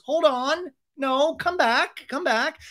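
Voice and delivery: high, drawn-out plea to return; imitated calling back. Host calling James back to the set, high pleading call. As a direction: stretch it out, calling after someone.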